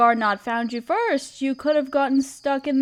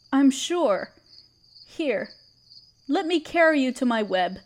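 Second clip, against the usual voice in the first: ordinary delivery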